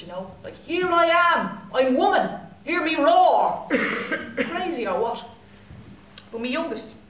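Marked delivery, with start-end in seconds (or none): 0.6-3.7 higher, louder, rising to a drawn-out finish; mock bold declaration. Self-conscious woman trying to be up for it, mock defiant. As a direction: proclaim it with hammed-up confidence. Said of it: "I was trying to be up for it".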